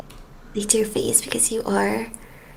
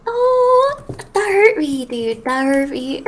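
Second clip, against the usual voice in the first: mock hurt; 5 semitones higher, 4 dB louder, much slower than usual. Mock hurt at Swedish remark.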